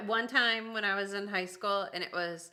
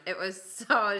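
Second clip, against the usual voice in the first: normal talking voice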